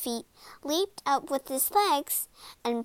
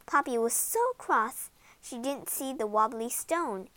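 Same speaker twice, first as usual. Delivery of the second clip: plain reading voice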